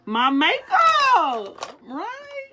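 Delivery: high, loud, drawn-out announcement; excited. Acted. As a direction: stretch it out, thrilled. Big reveal of topic.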